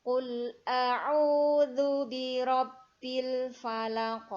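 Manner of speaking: a'udzu chanted slowly with a clear ain; careful tajwid recitation. Shown as a demonstration. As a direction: stretch a'udzu, ain from the throat. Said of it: "Ini bukan A ya, tapi A".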